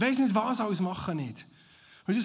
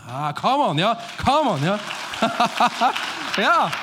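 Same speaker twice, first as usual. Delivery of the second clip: loud cheering "come on"; rousing call; 3 semitones higher, 9 dB louder than usual. Real, not acted. Pastor rallying the congregation, loud and excited. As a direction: whip up the crowd.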